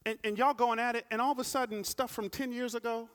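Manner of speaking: hammed-up exasperation over an old fight; animated preacher banter; high, loud comic preaching. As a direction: play up the absurdity for the crowd. Preaching humor at full force.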